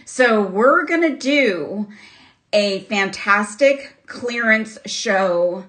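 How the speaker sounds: normal talking voice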